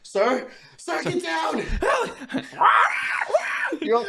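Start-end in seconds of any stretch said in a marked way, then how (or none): 0.0-1.6 high urgent shout to the officer; hammed-up panicked warning; mock warning shout. Warning cop of crow. 1.6-3.8 high loud cackling laugh; real high-pitched laughing fit; shrieking laugh. Real, not acted. After the shout.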